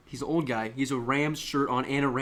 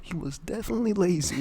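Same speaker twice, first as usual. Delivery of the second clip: higher-pitched, amused exclamation; raised-voice jibe; 6 semitones higher, 3 dB louder than usual. Pretend. Podcaster landing the jibe on co-host, higher, exclaimed. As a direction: pitch it up for the punchline. Repeats the joke out loud.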